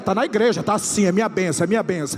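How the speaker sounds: rising to loud, high prophetic cries; prophesying. Acted. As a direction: build the prophecy to a shout. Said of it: "eu tô profetizando". (profecia crescendo até o grito)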